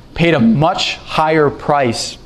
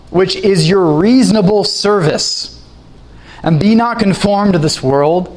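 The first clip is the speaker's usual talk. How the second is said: raised, pleading scripture recitation; scripture reading; 4 semitones higher than usual. Acted. Preacher to congregation, reading Paul in a raised pleading voice. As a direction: beseech them through the verse. Reads Romans twelve to congregation.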